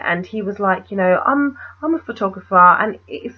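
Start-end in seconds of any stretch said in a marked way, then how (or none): 1.3-2.6 lightly imitated artsy photographer, higher pitched; artsy fartsy. Photographer introducing himself to a stranger, mock artsy fartsy. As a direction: introduce yourself in a mock arty voice. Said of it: "he sounded really artsy fartsy".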